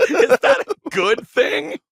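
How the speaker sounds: [name] impression. Retelling his reply, now in a hammed-up [name] voice. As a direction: ham up the rock singer, dragging it out with a laugh. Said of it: "Sounded like [name]".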